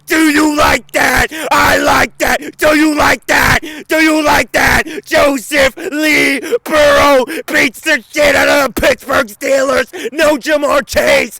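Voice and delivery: screaming, triumphant trash talk; gloating victory shout. Real, not acted. Sports vlogger screaming at his haters after the win. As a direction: yell it like you just won the game.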